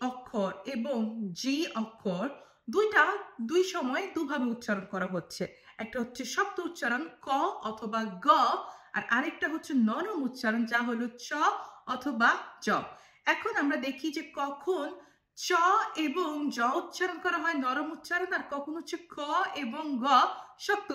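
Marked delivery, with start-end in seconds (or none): none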